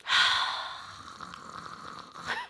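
long, airy, heavy sigh. Real, not acted. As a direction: let out one long breath of upset. Upset over stolen ornaments.

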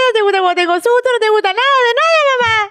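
put-on high, teary little girl complaining fast; whiny child tantrum. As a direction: wail it like an upset toddler. Daughter's tearful tantrum imitated. (niña lloriqueando en pleno berrinche, aguda)

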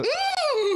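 high whinnying horse sound; horse neigh imitation. Showing off his horse neigh to the co-host. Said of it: "horse imitations".

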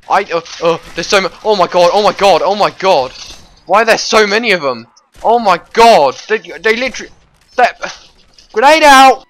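high, loud frantic screaming; panic intro. Acted. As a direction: freak out like you're doomed. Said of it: "I enjoy doing my panic intro".

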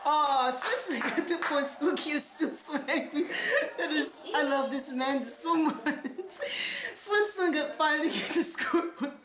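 talking through real laughter; can't stop laughing. Real, not acted. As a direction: keep commenting as the giggles break in. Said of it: "I couldn't stop laughing again".

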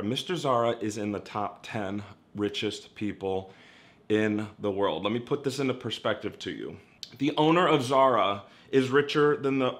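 usual speaking voice

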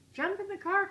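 excited cry. Acted. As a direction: shout it, grabbing the keys. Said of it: "cried Papa".

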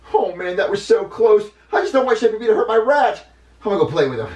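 high, loud, whiny character voice; relieved, anxious kid character. Acted. Junior alone, worried for his pet rat, fretting. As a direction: fret aloud in a high voice.

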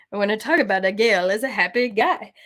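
put-on silly character voice. Shown as a demonstration. Showing the voice made for Gale, silly voice. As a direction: put on a goofy, playful voice. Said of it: "I made a silly voice".

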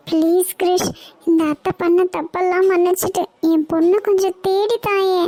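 high-pitched, anxious plea; distressed pleading. Acted. Aunt begging Krish to find her missing daughter, distressed. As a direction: beg him desperately for help. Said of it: "பதட்டப்படாதீங்க".

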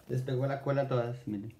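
usual speaking voice

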